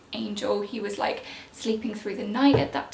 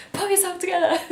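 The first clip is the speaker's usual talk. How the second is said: imitating partner; 8 semitones higher, 4 dB louder, faster than usual. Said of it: "Daniel was like".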